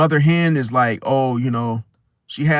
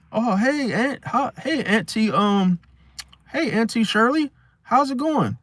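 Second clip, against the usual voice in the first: higher, hesitant mock greeting; imitated nephew; 8 semitones higher than usual. Nephew answering Auntie Shirley at the car, hesitant and high. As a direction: play a nephew stumbling through a hello, pitched up. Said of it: "And then you like".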